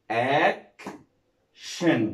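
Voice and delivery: action split into "ac", a long pause, "shun"; syllable by syllable. Shown as a demonstration. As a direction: sound out action in two separated pieces. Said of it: "شن زي كلمة".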